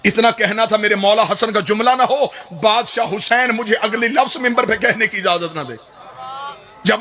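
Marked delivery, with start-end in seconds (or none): none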